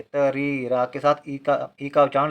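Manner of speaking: ordinary talk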